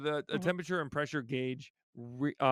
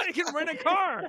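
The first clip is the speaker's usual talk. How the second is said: excited; 13 semitones higher, 5 dB louder than usual. Real, not acted. Teasing amazement.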